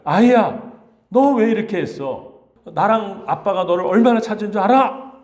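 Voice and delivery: a worried mother half-scolding her son. Retold or imitated. Mary scolding young Jesus after three days' search, mock scolding. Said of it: "라고 했을 거예요".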